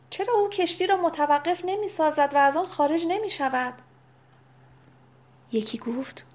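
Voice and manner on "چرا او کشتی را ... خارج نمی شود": high-pitched cry from an onlooker; shouted question. A narrator's reading. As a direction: call out the question in a raised, urgent pitch. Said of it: "دیگری فریاد زد". (فریادِ پرسشگر با صدای زیر)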